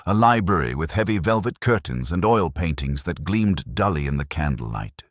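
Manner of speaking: usual speaking voice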